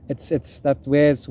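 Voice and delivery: ordinary delivery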